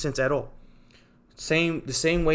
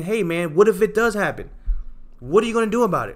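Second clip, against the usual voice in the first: higher and louder, pressing the point; raised, insistent challenge; challenging retort; 7 semitones higher, 4 dB louder, faster than usual. Retold or imitated. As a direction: fire the question back at the doubters. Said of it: "I'm saying".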